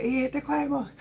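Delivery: husband mocking wife's excited shriek; imitating wife. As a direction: squeak it out like his thrilled wife. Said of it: "And she's like".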